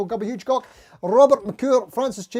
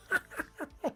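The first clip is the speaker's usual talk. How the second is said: high giggle; 8 semitones higher, 13 dB quieter than usual. Real, not acted. Laughs at forgotten stream title.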